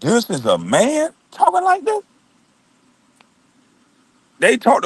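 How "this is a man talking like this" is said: drawn-out start climbing into high incredulity; retold disbelief. Overhearing how a man talks, mock incredulous. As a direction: stretch it out, then go high, aghast. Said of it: "I literally had to say".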